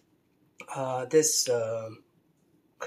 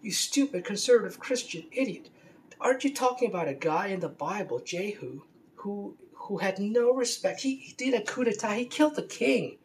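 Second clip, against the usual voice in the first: much higher, incredulous scolding; exasperated rant; 5 semitones higher than usual. Retold or imitated. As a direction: scoff at him in disbelief, pitch soaring. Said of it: "I said".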